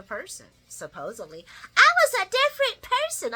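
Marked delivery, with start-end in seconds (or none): 1.8-3.3 much higher, louder mocking whine. Vlogger mocking Esme's excuse. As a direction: sneer it in a squeaky mock voice. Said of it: "I wanted to choke her".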